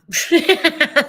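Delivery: loud high laugh; burst of laughter. Real, not acted. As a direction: laugh out loud at your own joke. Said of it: "Sorry, guys".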